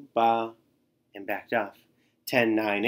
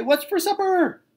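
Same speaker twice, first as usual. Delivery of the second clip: much higher, louder squawky voice; silly high squawk; 7 dB louder than usual. A narrator's reading. Tacky squawking his silly cheer line, high and loud. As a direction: squawk it like a goofy bird.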